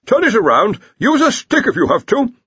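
shouted orders, pitched high and loud; shouting. Acted. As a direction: yell instructions from the beach. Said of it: "the Dean shouted".

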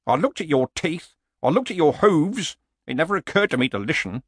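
put-on grumbling old barbarian. Old barbarian rues buying the horse, lightly grumbling. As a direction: grumble like a regretful old fighter.

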